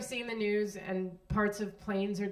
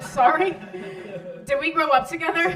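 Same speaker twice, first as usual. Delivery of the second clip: loud, high-pitched incredulous comeback; indignant disbelief; 7 semitones higher, 8 dB louder than usual. Retold or imitated. Startled rider answers a bossy stranger on the subway, loud indignation. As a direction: throw it back as an affronted question. Taken aback by stranger's bluntness.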